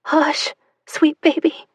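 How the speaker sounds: slow, high, trembling hush; mother hushing her crying baby, voice shaking; shaky murmur. Acted. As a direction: soothe the baby while your voice shakes. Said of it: "she murmured".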